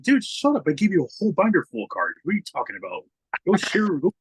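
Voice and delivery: mock scolding. Scolding Cinco to go share with the younger kid, playfully loud. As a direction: bark it like a fed-up big brother.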